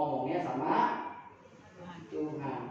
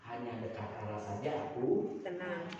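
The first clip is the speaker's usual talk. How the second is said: slow, soft; 10 semitones lower, 10 dB quieter than usual. Acted. Quoting David's calm conclusion.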